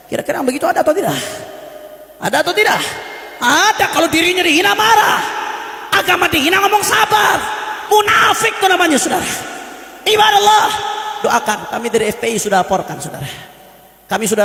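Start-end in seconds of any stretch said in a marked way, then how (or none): 0.1-10.7 fiery preaching. Acted. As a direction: call out the hypocrites, building to a shout. Preaching at full force. (ceramah berapi-api yang menuduh, makin keras)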